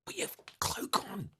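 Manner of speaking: breathy high urgent whisper; stage whisper. Acted. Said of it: "like, stage whisper".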